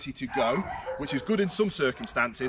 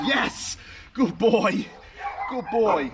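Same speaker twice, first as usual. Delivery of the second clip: lifted praise for a dog; high praise voice; 7 semitones higher, 4 dB louder, much slower than usual. Shown as a demonstration. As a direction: reward him with a high voice. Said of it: "more vocal inflection".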